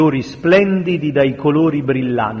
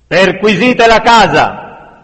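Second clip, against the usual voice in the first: loud, higher, drawn-out shouted order; angry bellow; 7 semitones higher, 8 dB louder, slower than usual. A narrator's reading. Mob leader orders a search of the house, bellowing. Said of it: "sbraitò una voce alterata".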